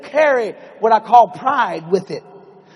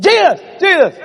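high, loud call for attention; 8 semitones higher, 4 dB louder than usual. Acted. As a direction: hail him urgently. Peter calls out to Jesus.

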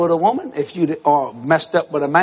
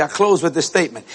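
ordinary talk

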